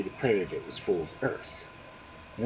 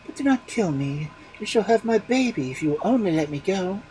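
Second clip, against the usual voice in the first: high, louder, begging; pleading wife; 8 semitones higher, 5 dB louder than usual. A narrator's reading. Wife begging the ogre for mercy, high. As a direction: plead for your life.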